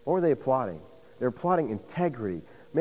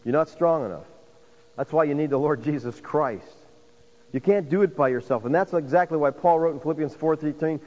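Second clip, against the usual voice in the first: ordinary talk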